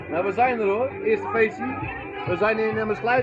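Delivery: high, loud over the music. Real, not acted. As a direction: shout over party noise. Speaking over party noise.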